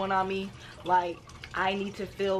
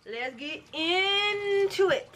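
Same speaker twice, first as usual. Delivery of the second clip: high slow sing-song intro; 10 semitones higher, much slower than usual. Acted.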